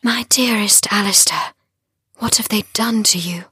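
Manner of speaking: high, whispered concern; sorrowful whisper. A narrator's reading. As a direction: whisper tenderly to a wounded lover. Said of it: "whispered to him through the shadows".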